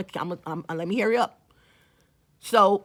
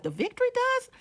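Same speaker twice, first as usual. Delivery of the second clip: high, rising baffled question; puzzled echo; 14 semitones higher, 4 dB quieter than usual. Retold or imitated. Repeating the friend's word back, puzzled and high. Confused echo of a product name.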